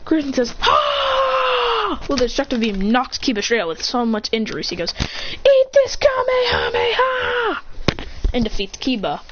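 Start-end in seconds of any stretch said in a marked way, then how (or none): 0.6-2.0 long, high battle yell; beam-release shout. Acted. 5.3-7.6 high, drawn-out battle cry; finishing-move shout. Acted.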